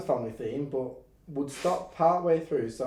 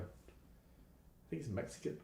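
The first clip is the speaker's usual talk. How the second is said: whispered; 22 dB quieter, much slower than usual. Real, not acted. Jokey aside about Jaeger.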